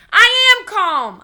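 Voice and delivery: very loud, high, slow yell; hollering. A narrator's reading. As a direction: holler it back defiantly. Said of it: "I hollered back".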